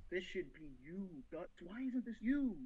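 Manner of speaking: soft, low, brooding murmur; quiet mock obsession; hushed longing. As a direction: mutter it like a lovesick schemer. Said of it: "Griffith is just thinking".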